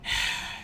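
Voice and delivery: a long, soft, bored sigh; a reluctant, weary exhale. Acted. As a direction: sigh out your boredom before reading. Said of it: "he said distastefully".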